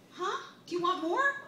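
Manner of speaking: put-on eager high mother-to-toddler voice; coaxing toddler.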